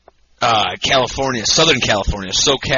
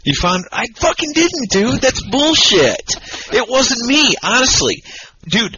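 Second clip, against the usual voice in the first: indignant denial; 10 semitones higher than usual. Real, not acted. Denying to his cohost he left the girl, indignant. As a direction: insist it wasn't him.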